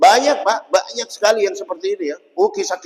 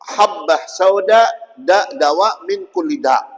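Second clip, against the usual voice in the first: slow hadith recitation; 2 semitones higher, 4 dB louder, slower than usual. Shown as a demonstration. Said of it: "Misalnya ada hadis".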